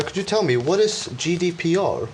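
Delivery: ordinary talk, nothing marked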